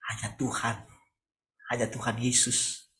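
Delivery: ordinary delivery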